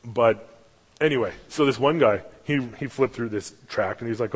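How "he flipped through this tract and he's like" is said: ordinary delivery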